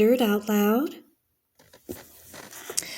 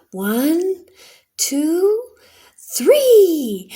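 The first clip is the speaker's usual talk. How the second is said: counting up, each number higher and louder; excited counting; 8 semitones higher, 6 dB louder, much slower than usual. Acted. Girls counting down before diving into the current, building excitement. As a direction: build the count to a gleeful shout on three.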